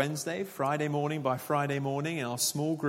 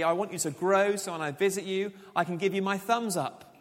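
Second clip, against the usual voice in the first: higher, lightly mocking quote of what Paul did not say; mock wrong version; 6 semitones higher, 5 dB louder than usual. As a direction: poke fun at the shallow version. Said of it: "He doesn't write to say".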